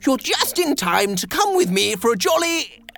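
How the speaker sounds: high, loud, exuberant character voice; cheerful invitation. Acted. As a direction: invite friends along with hammed-up glee. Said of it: "he cried cheerfully".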